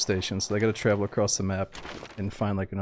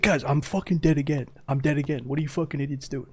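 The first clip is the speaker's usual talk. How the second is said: higher-pitched mock whine of a teammate dead again; imitating the dead teammate; 6 semitones higher than usual. Streamer imitating an enemy player who keeps dying, higher mock complaint. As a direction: play the fed-up teammate, pitched up. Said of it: "He's like".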